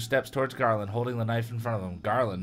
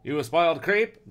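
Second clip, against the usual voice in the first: higher, loud scornful jab; acted contempt, more loud than muttered; scowling mutter; 6 semitones higher, 6 dB louder than usual. A narrator's reading. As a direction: sneer at the rich kid. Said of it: "Garland muttered with a scowl".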